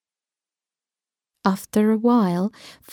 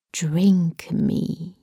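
whispered; 5 semitones lower, 3 dB quieter than usual. A narrator's reading. Reading the label.

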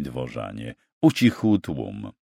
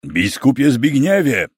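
raised, louder call across a crowd; 5 semitones higher, 5 dB louder than usual. A narrator's reading. Young knight hailing the bishop, calling out. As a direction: call out high and loud to be heard. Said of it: "zawołał".

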